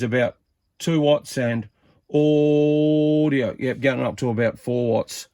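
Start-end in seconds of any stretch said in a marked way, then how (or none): 2.1-3.5 drawn-out audio check into the mic; radio test call. Real, not acted. Testing his CB transmit, drawn-out radio check call. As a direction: call it out slow like keying a CB.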